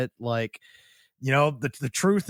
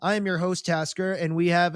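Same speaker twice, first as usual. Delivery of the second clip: podcast host intro; 6 semitones higher than usual. Acted.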